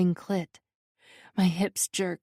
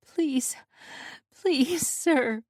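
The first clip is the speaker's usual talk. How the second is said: high begging with a cracking voice; cracking beg; 7 semitones higher, much slower than usual. A narrator's reading. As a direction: let the voice crack as you beg. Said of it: "I beg, my voice cracking".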